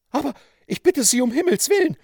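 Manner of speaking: high, loud, hurried woman's plea; dismayed cry. A narrator's reading. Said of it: "rief die bestürzte Frau". (bestürzter, hoher Ausruf einer Frau)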